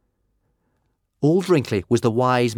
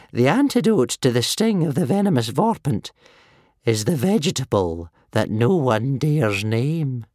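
put-on wheezy old man; 6 semitones higher, 4 dB louder than usual. A narrator's reading. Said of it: "wheezed old Wrinkly".

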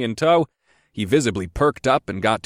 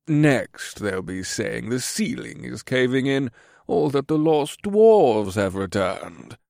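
sneering courtier; 2 semitones higher, slower than usual. A narrator's reading. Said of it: "in a low voice".

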